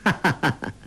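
acted confident chuckle; quick run of gloating laughs; villain's laugh. A narrator's reading. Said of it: "欧阳克笑道".

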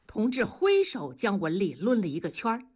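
ordinary delivery, nothing marked

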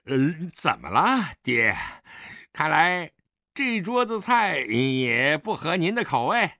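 casual swagger toward his father; offhand, brazen. Acted. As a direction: ask it breezily, without respect. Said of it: "大喇喇地问道".

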